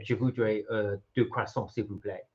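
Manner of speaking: put-on low, quiet French with an English accent; strong English accent. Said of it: "in a real strong English accent".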